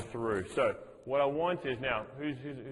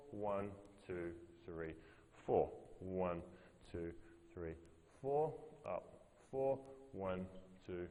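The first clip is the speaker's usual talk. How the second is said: rhythmic count with a double pulse; counting out the tempo; 3 semitones lower, 6 dB quieter, much slower than usual. Shown as a demonstration. Coach counting the class through slow reps, steady and rhythmic. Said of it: "Let's go super slow and give me a double tap".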